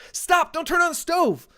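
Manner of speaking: high-pitched reenacted panic yell; retold dad scream. Dad to oldest daughter at the stove, retold scream. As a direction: mimic yelling out to stop a child in an instant. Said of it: "involuntary dad reaction and scream out".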